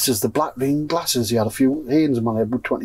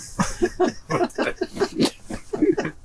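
a real wheeze of silent laughter, pitched high; 5 dB quieter than usual. Real, not acted. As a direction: laugh almost soundlessly at your own punchline. Laughs at the punchline.

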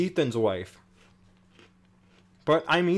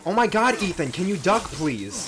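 frantic; 6 semitones higher than usual. Real, not acted. Gamer yelling at companion Ethan, frantic high plea. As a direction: beg him to get out of the way. Pleading with game character mid fight.

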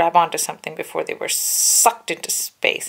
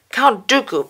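put-on raised, louder old politician; Palpatine voiced; 7 semitones higher, 6 dB louder than usual. A narrator's reading. Palpatine warning the Jedi, louder and higher. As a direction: call out the name in alarm. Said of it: "Palpatine said".

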